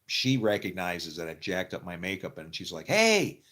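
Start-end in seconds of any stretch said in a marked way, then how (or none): 2.9-3.4 much higher, loud call across the set. Retold or imitated. Makeup lady calling across the set, high and loud. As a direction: shout hey to get his attention.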